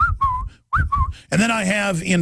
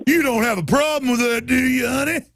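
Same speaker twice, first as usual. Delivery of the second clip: high, smarmy officer; mock cop to caller; 6 semitones higher than usual. Host playing a cop to caller Diana, high and smarmy. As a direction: lean on her like a pushy cop.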